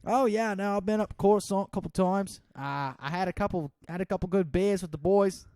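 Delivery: high, loud mock Aussie bloke; hammed-up Aussie larrikin; Australian accent. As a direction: brag like a rowdy Australian. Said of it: "Australian vs not so".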